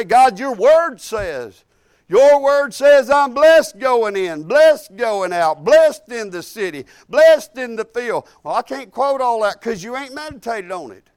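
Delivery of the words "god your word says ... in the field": slow, loud declaration of the promises; forceful confession. Shown as a demonstration. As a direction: proclaim each "blessed" slowly and loudly, like a vow to God. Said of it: "You talk to God and you say".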